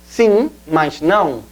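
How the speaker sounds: slow, careful Portuguese "sim, mas não"; Portuguese "sim, mas não", slowly. Shown as a demonstration. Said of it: "En portugués sería".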